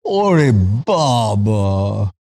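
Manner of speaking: drawn-out mock exclamation of dismay; sister's exasperated cry. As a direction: stretch it out like a fed-up old sister. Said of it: "And she'd go like".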